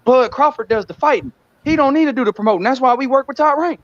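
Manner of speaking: squeaky mock impression of the team's spin; mocking Bud's team. Mocking Bud's team to the stream, in a high put-on voice. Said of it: "like his team say".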